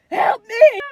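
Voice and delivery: high, very loud cry for help; desperate yell. Retold or imitated. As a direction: re-enact a desperate shout for help. Clinging to partner during retold labor.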